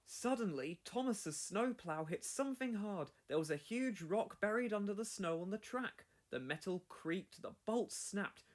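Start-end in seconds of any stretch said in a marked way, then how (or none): none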